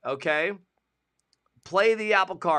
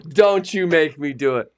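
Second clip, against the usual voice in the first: louder, playful stern warning; mock stern warning; 7 dB louder than usual. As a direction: warn them off, half joking. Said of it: "done it in Australian accent".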